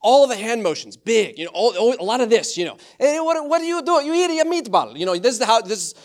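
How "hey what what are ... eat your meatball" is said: high, loud mock Italian family nagging; hammed-up Italian scolding, pitched way up. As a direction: play an over-dramatic Italian relative at dinner. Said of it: "There's Italian accents all over the place".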